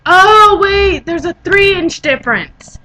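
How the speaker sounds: high, loud "oh" then slowed; sudden realization. Retold or imitated. Ditzy friend realizing her math error, surprised and slowed. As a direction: catch the mistake mid-thought. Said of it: "She's like".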